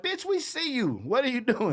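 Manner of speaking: pitched well up, teasing the hiding guy; a high jeering call across the dark; mock call-out. Mocking the tall friend hiding in shadows, teasing high call. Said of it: "I'm like".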